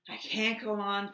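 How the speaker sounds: gasping out the last of his strength; puffing. A narrator's reading. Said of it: "puffed the dragon".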